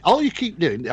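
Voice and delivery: put-on a light Scottish accent giving directions; imitating the Scottish family's directions in a mild Scottish accent. Host retelling the Scottish family's directions, in a light Scottish accent. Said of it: "I'm doing this in the Scottish accent".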